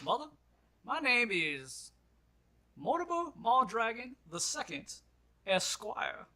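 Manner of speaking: put-on high, slow, grand old man introduction; old miner's voice. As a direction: announce your full name with pomp. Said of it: "he goes".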